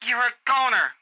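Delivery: loud, high female threat; shouted threat. Acted. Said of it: "a female's voice shouting a violent threat".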